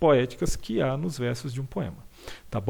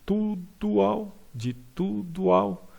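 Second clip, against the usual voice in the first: de tudo ao drawn out slowly, tudo and ao linked higher; slow demonstration; 6 semitones higher, much slower than usual. Shown as a demonstration. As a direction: stretch out de tudo ao to show the vowels joining. (de tudo ao bem devagar e mais agudo)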